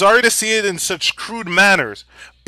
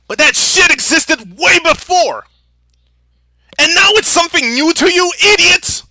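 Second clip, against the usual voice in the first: angry yelling; 8 semitones higher, 6 dB louder than usual. Real, not acted. Ranting at narrative pushers.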